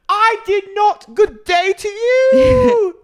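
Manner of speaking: very high, slow, loud mock British; Meredith's British accent. Meredith storming off from Angie, sung-out mock British shout. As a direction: sing out a hammed-up British send-off. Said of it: "goes back into a British accent".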